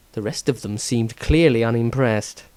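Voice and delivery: ordinary delivery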